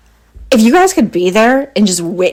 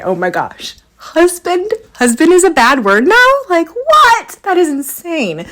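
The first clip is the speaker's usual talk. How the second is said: shocked, higher disbelief on husband; retold disbelief; 10 semitones higher than usual. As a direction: replay your own stunned reaction. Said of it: "I'm sitting here going".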